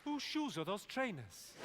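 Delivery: slow, puzzled Welsh question; mock Welsh bewilderment; confused Welsh accent. Shown as a demonstration. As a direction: ask slowly, baffled, in Welsh accent. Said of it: "you've just got to sound confused".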